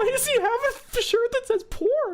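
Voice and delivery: very high-pitched incredulous cry; shocked outburst. Real, not acted. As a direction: squawk it in disbelief. Startled reaction to video.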